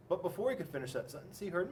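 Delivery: plain reading voice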